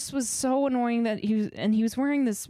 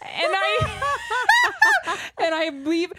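high, loud laughing words; laughing through it; 11 semitones higher, 5 dB louder, much slower than usual. Real, not acted. Telling a friend the awkward exit, laughing hard. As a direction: laugh as you tell it.

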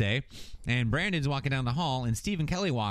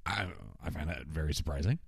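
stiff dad; 9 semitones lower, 6 dB quieter than usual. Retold or imitated. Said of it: "pregnant pause where he's like".

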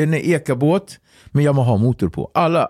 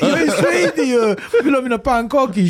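laughing; 11 semitones higher than usual. Retold or imitated. Joking that as a Swede he just wants pancakes, giggling. Jokey self-quote through laughter.

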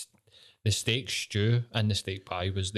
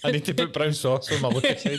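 laughing; 3 semitones higher than usual. Real, not acted. Laughing over the brown sauce joke.

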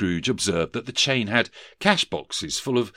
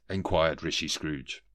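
usual speaking voice

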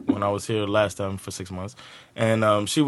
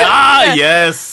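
father-in-law; 6 semitones higher, 7 dB louder than usual. Retold or imitated. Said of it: "he was like".

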